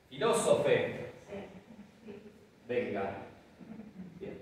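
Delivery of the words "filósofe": Filósofe said slowly, stress on the first o; slow demo pronunciation. Shown as a demonstration. Teacher models Filósofe slowly for students. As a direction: sound out Filósofe slowly for the class. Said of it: "Hasta ahora mantenemos".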